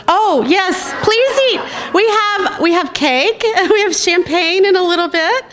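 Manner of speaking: high, loud cheerful call to the room; excited calling out. Real, not acted. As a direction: call everyone over gleefully. Hostess calling guests to eat.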